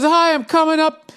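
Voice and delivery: high, sweet mock-wife voice; a chirpy put-on wife asking a favour; husband mimics his wife's cheery request on the phone, high and sing-song; imitating his wife. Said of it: "She goes".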